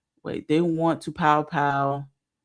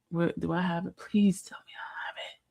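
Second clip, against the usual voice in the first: whispering to herself; 2 semitones higher, 7 dB quieter than usual. Real, not acted. Searching for the video.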